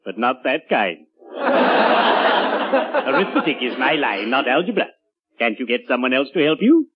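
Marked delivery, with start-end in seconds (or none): 1.3-3.0 breathy high cackle at his own joke; wheezy laugh. Acted.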